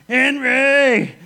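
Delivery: loud, high squashed yell; pinned evangelist shouting for his brother again; cry again. Retold or imitated. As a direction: bellow the name in dismay. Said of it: "Benny says one thing".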